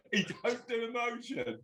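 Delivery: laughing high while repeating it; giggling in disbelief. Real, not acted. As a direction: repeat his words through a high laugh.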